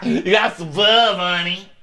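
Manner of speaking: hammed-up brassy bar lady; mock brash lady. Mimicking brash Jamie.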